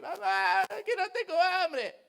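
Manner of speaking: mock tearful kid begging mom; whiny child. Boy pleads to his mother at night, whiny. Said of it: "yo llorando ahí".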